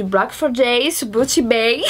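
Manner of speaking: put-on brand names in a playful English accent; mock English accent inside French. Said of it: "faut que j'arrête avec cet accent anglais".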